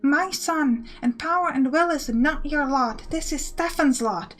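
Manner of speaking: a firm mother setting down the rules; stern mother. Acted.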